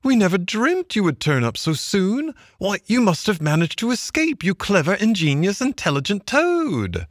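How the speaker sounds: high-pitched, gushing Mole; delighted admiration. A narrator's reading. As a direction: gush with praise in a high little voice. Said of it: "began to dance round him".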